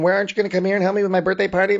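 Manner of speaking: rattled-off fast imitation of nagging; hurried mocking retelling of a pestering caller. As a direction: rush it into a whiny blur. Voicing Vanessa's nagging phone call.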